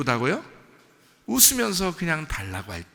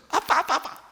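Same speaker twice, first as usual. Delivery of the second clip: put-on high, excited child pestering a parent; gleeful begging; 11 semitones higher than usual. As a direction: act out a child happily nagging for a present. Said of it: "웃으면서 막". (신나서 조르는 아이 흉내, 높은 목소리)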